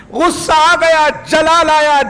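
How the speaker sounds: high, emphatic preaching burst; fervent sermon peak; rising fervour. A narrator's reading. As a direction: lift the voice high on each short phrase. Narrating Ibrahim's rising anger.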